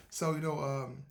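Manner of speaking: ordinary talk, nothing marked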